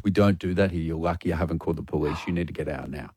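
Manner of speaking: very low, soft telling-off; quiet barman ordering someone out; barman's warning. Retold or imitated. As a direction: lean in with a hushed, firm warning. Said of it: "And he goes".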